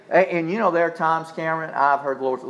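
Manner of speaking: normal talking voice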